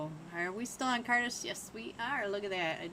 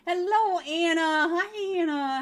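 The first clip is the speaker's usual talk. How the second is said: high, loud delighted hello; excited greeting; 9 semitones higher, 12 dB louder than usual. Real, not acted. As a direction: greet a friend with a squeal. Greeting arriving co-caster.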